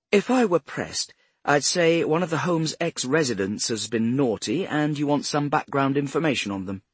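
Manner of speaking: high-pitched well-spoken lady, self-assured; cultured older woman. A narrator's reading. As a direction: play a retired care-home head answering police. Voices Mrs Swift in the dialogue.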